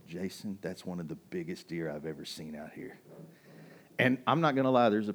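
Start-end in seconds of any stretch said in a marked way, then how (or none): 0.1-3.0 low, softer voice to a hunting buddy; hushed aside. Retold or imitated. Hunter to his buddy in the stand, hushed. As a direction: quietly point out a huge deer.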